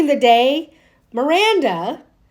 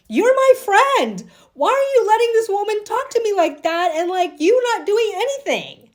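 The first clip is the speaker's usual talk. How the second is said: going off on Carrie; 7 semitones higher than usual. Retold or imitated. Miranda confronting Carrie over her silence, high hurt reproach. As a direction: confront your friend for not defending you. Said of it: "go off on Carrie".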